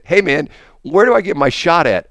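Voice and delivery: high, loud hail for directions; calling out. Retold or imitated. Calls out to ask skateboarders directions.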